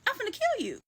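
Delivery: very high-pitched exasperated threat; mock threat. To the cat after it clawed her leg, mock threat.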